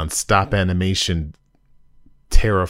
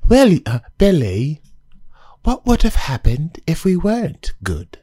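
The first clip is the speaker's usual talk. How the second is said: put-on high, louder kid voice; child's voice; 11 semitones higher, 4 dB louder than usual. Reviewer quoting the kids at the episode's end, high child voice. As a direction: ask it like a wide-eyed little kid. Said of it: "the kids asked each other".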